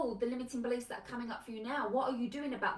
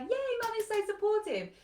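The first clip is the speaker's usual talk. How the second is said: put-on higher, chirpy kid delighted with mum; happy child voice; 11 semitones higher, 4 dB louder than usual. Child about the supportive mum, chirpy and pleased. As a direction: play the naive child, thrilled.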